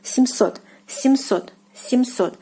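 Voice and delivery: семьсот stressed on the final O, "sem-SOT". Shown as a demonstration. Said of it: "The stress here is on O again".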